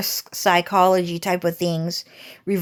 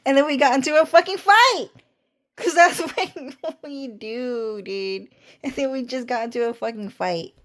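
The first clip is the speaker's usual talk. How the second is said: laughing; 4 semitones higher than usual. Real, not acted. Laughing retelling the fight.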